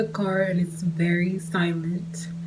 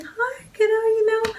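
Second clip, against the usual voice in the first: put-on much higher, sing-song hi to a child; cutesy greeting to a kid; 3 dB louder than usual. Greeting her date's little son in a much higher cutesy voice. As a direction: sweeten up for a little boy.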